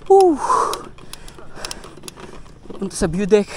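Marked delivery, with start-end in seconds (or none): 0.1-0.4 loud joyful whoop; excited whoop. Real, not acted. Downhill rush, cheering.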